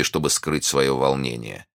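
usual speaking voice

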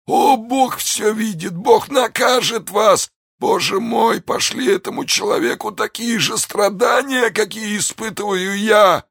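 very high, slow, strained wail; agonised curse. A narrator's reading. Poisoned soldier begging God to punish D'Artagnan, agonised wail. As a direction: call down judgment through dying agony.

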